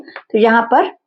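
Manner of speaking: normal talking voice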